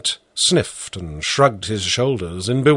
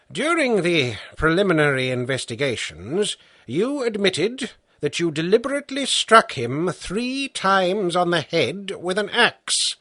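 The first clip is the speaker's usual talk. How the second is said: stern judge; 7 semitones higher than usual. A narrator's reading. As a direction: lay out the charge like a patient magistrate. Judge reads the charge.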